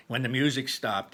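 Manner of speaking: plain reading voice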